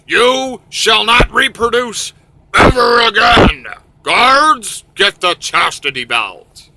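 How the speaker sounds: slow, booming mock proclamation; high, loud hammed-up royal order; commanding decree. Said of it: "John New says".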